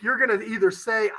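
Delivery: normal talking voice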